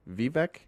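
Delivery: "Vivek" as VEE-veck, long ee first; alternative pronunciation. Shown as a demonstration. Host testing the name on viewers, "Vivek" as VEE-veck. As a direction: try "Vivek" as a rising, questioning VEE-veck. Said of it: "How do you say it?".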